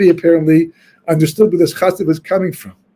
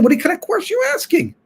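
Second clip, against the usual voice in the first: high-pitched, scandalized rebuke; indignant outrage; 13 semitones higher, 4 dB quieter than usual. Retold or imitated. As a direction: sound shocked anyone would dare ask. Outraged chassidim rebuke the questioner.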